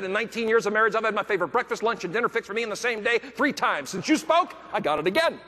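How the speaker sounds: high, thrilled retelling with a laugh at the end; excited bragging. Retold or imitated. Husband proudly telling the speaker his wife cooked for him, high and excited. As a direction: brag like you can't believe your luck. Brian brags about his wife's cooking.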